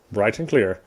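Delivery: normal talking voice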